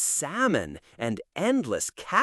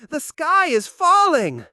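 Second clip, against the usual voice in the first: panicked cry, louder; 11 semitones higher, 6 dB louder than usual. Acted. Panicked bird repeats.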